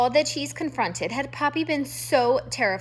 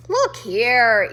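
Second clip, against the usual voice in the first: louder, gruff call; 7 dB louder than usual. A narrator's reading. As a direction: demand his attention. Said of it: "Irith cried".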